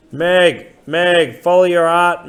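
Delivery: high, loud parrot squawk calling her name; parrot croak. Acted. The old man croaking like a parrot at Meg, a high loud squawk. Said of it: "croaked amazingly like the parrot".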